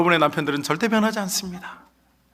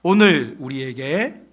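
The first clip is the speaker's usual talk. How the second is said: slow, drawn-out, louder reading cue; 3 semitones lower, 3 dB louder, slower than usual. Shown as a demonstration. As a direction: lead the congregation to read along. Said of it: "같이 읽어보실까요". (회중을 이끄는 느린 낭독)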